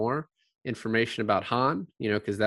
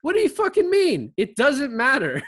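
sudden high, loud exasperated outburst; hammed-up indignant yell; mock outrage; 14 semitones higher, 7 dB louder than usual. Mocking the other's argument.

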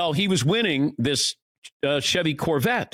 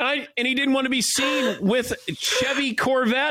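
high, laughing retelling; giggling while telling it; 9 semitones higher than usual. Real, not acted. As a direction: laugh through the words at the absurdity.

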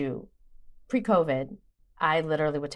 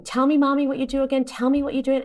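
put-on higher, louder eager child voice; imitating her son; 6 semitones higher, 4 dB louder, faster than usual. Mother retelling her son asking what she does for work. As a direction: play the son, keen to get it right.

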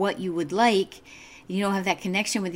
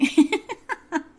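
a short real burst of laughter; amused laugh; 10 semitones higher, 5 dB louder than usual. Real, not acted. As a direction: laugh at the irony of it. Laughs at her back injury irony.

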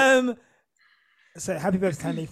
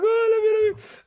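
much higher, loud mock-tearful gush; 13 semitones higher, 4 dB louder, faster than usual. Mocking how his co-host gushed while crying. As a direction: imitate her soppy love you. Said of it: "you're just like".

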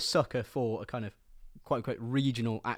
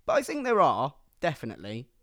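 normal talking voice

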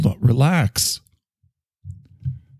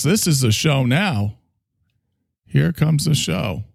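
hyped show host, high; announcer intro; 5 semitones higher than usual. Acted.

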